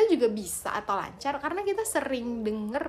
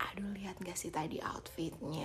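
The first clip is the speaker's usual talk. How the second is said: whispering; 6 semitones lower, 12 dB quieter than usual. Acted. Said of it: "kita actingnya jago ya".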